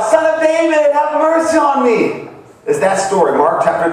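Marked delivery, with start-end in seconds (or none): 0.0-2.3 high-pitched pleading call; crying out. Retold or imitated. Preacher retelling the blind man's cry to Jesus, crying out high. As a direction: call out for mercy in a raised, ringing voice. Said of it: "He cried out".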